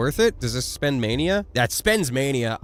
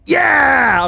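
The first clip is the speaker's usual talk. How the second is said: shout; 12 semitones higher, 10 dB louder than usual. Real, not acted. Cheer while playing.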